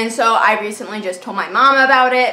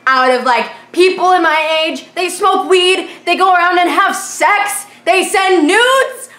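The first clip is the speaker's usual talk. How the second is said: exasperated rant; 7 semitones higher, 4 dB louder than usual. Real, not acted. Exasperated rant, high and loud.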